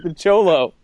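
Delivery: normal talking voice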